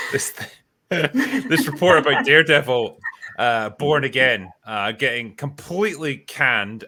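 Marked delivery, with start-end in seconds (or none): 0.0-3.0 talking through a laugh; louder, laughing as the words come out. Real, not acted. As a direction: try to announce it while cracking up. Laughs while introducing the news.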